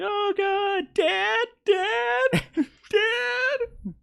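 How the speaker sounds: very high falsetto cries, played for laughs; hammed-up high-pitched wail for Dad; mock panicked screaming. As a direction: imitate a panicked crowd in a squeaky falsetto. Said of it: "Everyone starts screaming".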